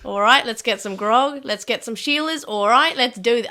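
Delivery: hammed-up rowdy Aussie; put-on Australian accent. Australians calling mates to the pub, exaggerated Australian accent. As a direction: rouse the mates in broad Australian. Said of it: "putting on Australian accent".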